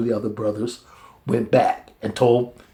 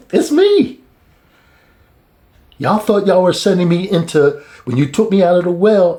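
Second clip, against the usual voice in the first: loud, raised mock reproach; Joseph confronting; 6 semitones higher, 4 dB louder than usual. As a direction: remind them firmly, voice up.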